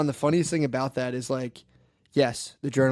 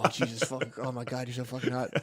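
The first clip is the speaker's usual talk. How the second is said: hushed, breathy mock moaning, much softer; mock aroused moan; 4 semitones lower, 9 dB quieter than usual. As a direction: groan it under your breath like a parody. Mock jerking off to video.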